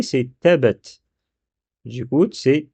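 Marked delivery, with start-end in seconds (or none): 0.4-1.0 "tabbat" with one short b; undoubled b in "tabbat"; single b, as written. Shown as a demonstration. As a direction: read "tabbat" without doubling the b. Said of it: "Parce que ce qui est écrit".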